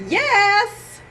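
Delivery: put-on high little kid's yes; child's voice. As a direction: answer like a small boy. Child's answer.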